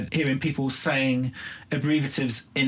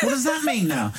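incredulous mock shout; 11 semitones higher than usual. To her son about the new slang, high and laughing in disbelief. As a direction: act the baffled parent, half laughing.

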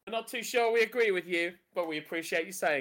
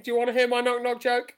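ordinary delivery

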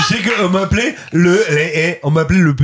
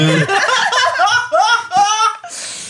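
high laugh. Real, not acted. Bursts out laughing.

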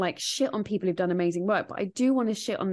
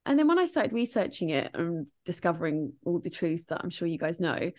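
usual speaking voice

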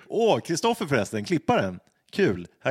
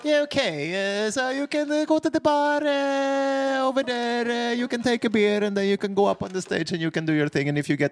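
put-on high, cheerful French-accented barmaid; 8 semitones higher than usual. French barmaid lets the man perform, in a high cheerful accent. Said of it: "hon blir lite gladare och bara".

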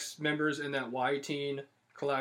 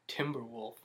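whispered; 6 semitones lower, 11 dB quieter than usual. Acted. Listing fan colors.

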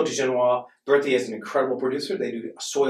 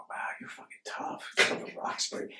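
awed whisper; 11 dB quieter than usual. Retold or imitated. People in the burbs reacting to a Roxbury kid, awed whisper. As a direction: imitate a hushed, impressed whisper.

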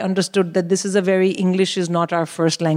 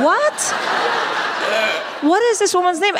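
much higher, squeaky incredulous What; 10 semitones higher than usual. Retold or imitated. Caller stunned the receptionist knew the name, incredulous. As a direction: disbelief that the guess worked. Baffled the name was accepted.

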